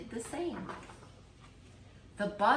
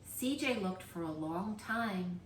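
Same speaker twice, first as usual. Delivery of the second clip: ordinary delivery, nothing marked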